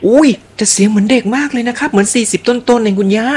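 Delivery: high-pitched, genuine surprise; amazed. Real, not acted. Host marvelling at grandma's youthful voice, amazed. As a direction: exclaim in high-voiced disbelief.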